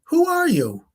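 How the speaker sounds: high-pitched imitation of a bewildered woman; baffled wife. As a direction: put on a high voice for a puzzled wife. Wife fails to recognise her husband's friend.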